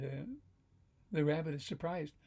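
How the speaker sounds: ordinary delivery, nothing marked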